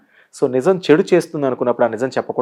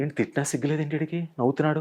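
muttered under the breath, much softer; muttered thought; 13 dB quieter, faster than usual. Retold or imitated. Insulted man grumbling to himself about the smiling guest, muttered softly. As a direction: grumble it to yourself in disbelief.

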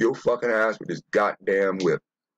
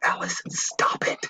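unvoiced hushed whisper. Whispering to the creepy listening computer. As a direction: hiss it under the breath. Whispering back to the smart speaker.

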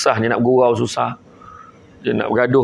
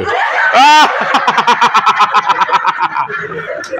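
loud shrieking laughter; real laughing fit at someone caught recording; big laugh; 7 semitones higher, 4 dB louder than usual. Real, not acted. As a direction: burst out laughing loud and high. Audience member caught recording.

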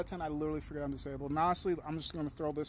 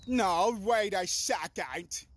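louder, drawn-out "wait a second" in disbelief; mock double take; 3 semitones higher, 5 dB louder, much slower than usual. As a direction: stop short and stretch out the realisation. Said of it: "It's like".

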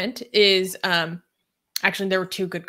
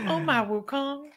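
slow, higher mock Chinese accent; drawn-out Wukong in a Chinese accent; 5 semitones higher, 5 dB quieter than usual. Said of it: "Did you do a Chinese accent?".

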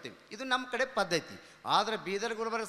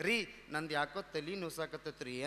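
soft coy complaint; 5 semitones lower, 7 dB quieter than usual. Retold or imitated. Wife complains sweetly on honeymoon.

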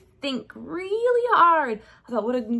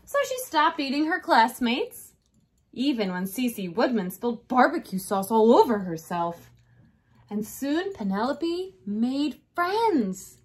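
ordinary delivery, nothing marked

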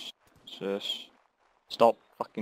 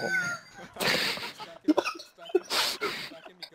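high squealing laugh; 3 dB quieter than usual. Real, not acted. Laughs at teammate's insult.

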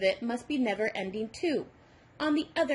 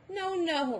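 higher, pleading protest; crying out in protest; 8 semitones higher than usual. A narrator's reading. Robert begging the number devil to stop, pleading higher. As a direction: beg him to stop, voice up high. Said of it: "Robert cried".

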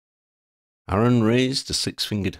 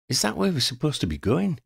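high-pitched puzzled question; confused, higher-voiced query; 9 semitones higher than usual. A narrator's reading. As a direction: ask it lightly, pitched up and puzzled. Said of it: "Christie asked, confused".

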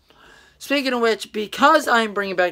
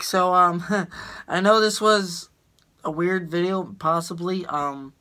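ordinary delivery

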